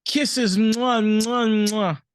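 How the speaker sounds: high, gushing send-off with kisses; playful affectionate shout-out. Pretend. Shout-out to Brooklyn mothers, high and playfully loving.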